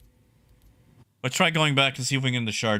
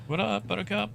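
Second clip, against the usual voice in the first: playful greeting; 9 semitones higher, 5 dB quieter than usual. Acted. Greeting chat viewer playfully.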